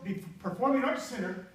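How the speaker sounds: ordinary delivery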